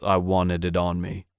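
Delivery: ordinary delivery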